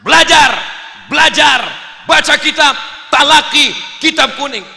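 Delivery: loud, high rallying shouts; punchy motivational shouting; rousing spirit. Acted. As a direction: fire out each command like a pep talk. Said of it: "bangkitkan semangatnya".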